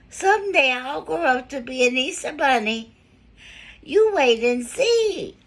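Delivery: a small bunny boasting; little girl bunny. A narrator's reading. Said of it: "little cotton ball of a tail said".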